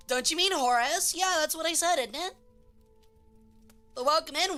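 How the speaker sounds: usual speaking voice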